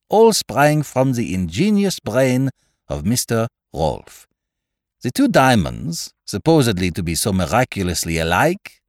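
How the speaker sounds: put-on French-accented detective, animated and emphatic; Poirot, explaining. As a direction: lay out the solution with a French accent.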